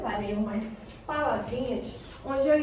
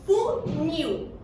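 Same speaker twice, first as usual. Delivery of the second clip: "Funil" pitched up, weight on "nil"; stress on final syllable; 5 semitones higher than usual. Shown as a demonstration. Teacher quizzes the class on the tonic syllable, stressing "nil" in "Funil". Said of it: "Qual é a minha sílaba tônica?".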